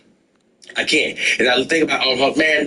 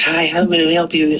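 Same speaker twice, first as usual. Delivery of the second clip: higher polite bank teller voice; lightly voiced bank teller; 4 semitones higher than usual. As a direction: do the cheerful teller greeting. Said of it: "they looking at me and say".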